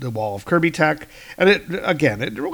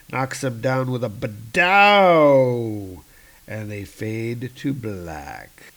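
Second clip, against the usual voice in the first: ordinary delivery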